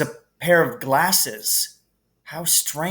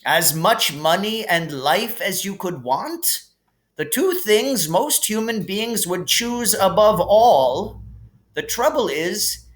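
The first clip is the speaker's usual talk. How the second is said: put-on kindly old headmaster; 7 semitones higher, 3 dB louder than usual. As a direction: share a gentle lesson about death like a wise old wizard. Narrator voices Dumbledore.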